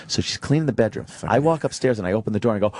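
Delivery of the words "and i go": ordinary talk, nothing marked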